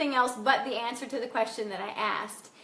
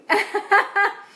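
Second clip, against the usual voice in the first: real laugh; 7 semitones higher, 5 dB louder than usual. Real, not acted. Laughing at a viewer comment.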